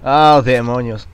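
loud, slow drawn-out groan of annoyance; frustrated outburst. Real, not acted. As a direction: let the frustration spill out loud. Frustración tras el combate. (arrebato de frustración, fuerte y lento)

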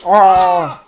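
very high, loud sudden yelp; startled shout. Real, not acted. Gamer startled by a crash, a sudden high yelp. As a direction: jolt out the cry in shock.

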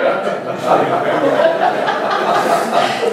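a real airy laugh, rising; breathy laugh. Real, not acted. Speaker laughing at the jealous woman's confession, airy laugh.

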